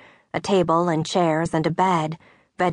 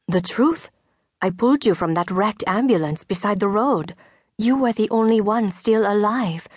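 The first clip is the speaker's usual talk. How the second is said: Veronique; 4 semitones higher than usual. Acted. Veronique recalls the rescue.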